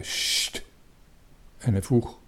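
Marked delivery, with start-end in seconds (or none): 0.0-0.6 long unvoiced "shh"; shushing. A narrator's reading. Little captain silencing the excited friends, sharp shush. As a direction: hush them with a hiss. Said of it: "Maar de kleine kapitein zei".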